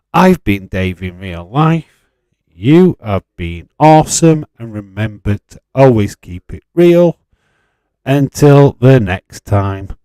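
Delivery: slow, loud show-host farewell; outro sign-off. Acted. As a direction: sign off like a TV presenter.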